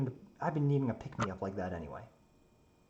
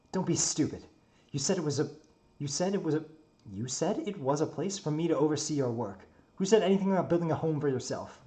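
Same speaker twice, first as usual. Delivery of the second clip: raised, higher-pitched objection; mildly outraged, challenge his excuse; indignant scolding; 5 semitones higher, 5 dB louder than usual. Acted. Narrator character scolds the builder.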